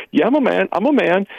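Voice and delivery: a hint of a proud little kid; lightly voiced little boy. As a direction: pipe up like a small boy. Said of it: "the little boy would go up and say".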